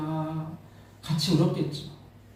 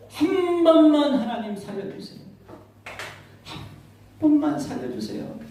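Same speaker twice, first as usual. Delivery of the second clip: higher, pleading voice with a catch of tears; tearful pleading prayer; 8 semitones higher than usual. Retold or imitated. A father praying tearfully for his dying daughter. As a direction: beg God to spare his daughter. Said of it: "막 기도하는데".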